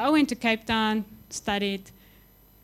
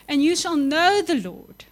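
louder, higher proclamation; proclaimed; 5 semitones higher, 6 dB louder than usual. Acted. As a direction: ring it out to the room. Preacher proclaims the verse.

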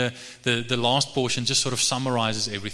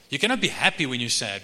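normal talking voice

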